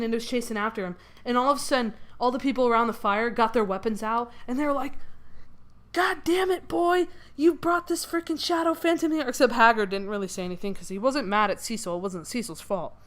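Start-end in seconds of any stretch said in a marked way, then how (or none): 5.9-9.3 mock angry yelling. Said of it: "everybody was yelling at Cecil".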